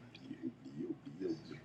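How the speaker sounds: very low, barely audible mutter; muttered dismissal. Real, not acted. Vlogger backing off a remark about Latinos, muttered very quietly. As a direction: mumble it away under the breath. Drops a risky topic, muttering.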